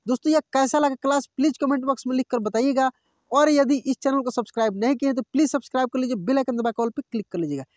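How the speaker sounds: fast, run-together sign-off; quick YouTube outro plea; rushed outro. Acted. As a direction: hurry through the subscribe request.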